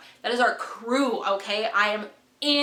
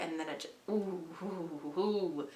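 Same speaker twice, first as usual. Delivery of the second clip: soft, low pained ooh; 6 semitones lower, 12 dB quieter than usual. Real, not acted. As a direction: wince at the memory. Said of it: "hurts me as a person".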